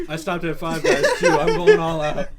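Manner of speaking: mock Jacob voice. Imitating Jacob with fries.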